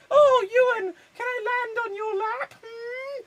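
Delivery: very high-pitched fairy voice; put-on falsetto fairy, loud on the exclamations; mock fairy. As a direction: squeak it out like a hammed-up fairy. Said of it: "I think I may be a fairy".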